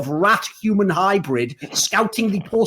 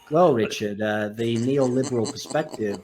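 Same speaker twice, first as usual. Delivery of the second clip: low, dry political pundit; lower, subdued mock pundit; C-SPAN pundit; 9 semitones lower, 5 dB quieter than usual. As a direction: parody a stuffy TV panel. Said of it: "like fucking C-SPAN".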